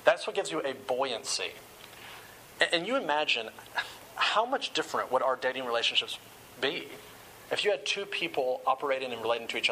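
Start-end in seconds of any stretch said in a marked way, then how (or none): none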